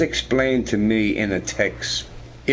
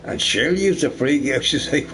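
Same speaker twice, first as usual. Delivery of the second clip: ordinary talk, nothing marked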